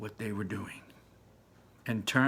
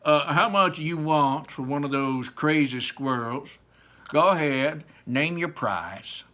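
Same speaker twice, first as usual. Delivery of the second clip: louder, self-important businessman; pompous rich father; 3 semitones higher, 6 dB louder than usual. Acted. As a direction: wave the wallet and name a price. Mr Salt offers money.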